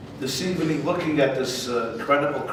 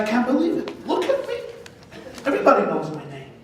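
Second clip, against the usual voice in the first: much higher, then fast, incredulous; mock disbelief; 8 semitones higher, faster than usual. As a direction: act stunned that she doesn't know you. Trump amazed at being unknown.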